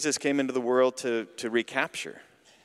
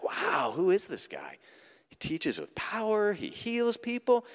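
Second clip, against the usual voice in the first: amazed onlooker; 7 semitones higher, 4 dB quieter than usual. Retold or imitated.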